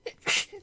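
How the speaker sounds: a sudden real sneeze; sharp unvoiced sneeze burst; sneezing. Real, not acted. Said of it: "I'm going to sneeze".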